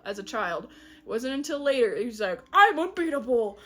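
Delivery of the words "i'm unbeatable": loud, cocky cheer; mock boasting. Prophecy cat who never loses a fight, loud mock boast. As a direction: crow it loudly like a hammed-up champion.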